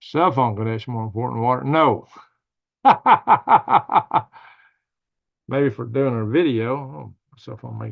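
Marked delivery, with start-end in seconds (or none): 2.8-4.4 big burst of laughter; loud laugh. Real, not acted. Laughing at his own joke about phones, loud burst. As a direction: laugh out loud, high and hard.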